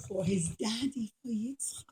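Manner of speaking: ordinary delivery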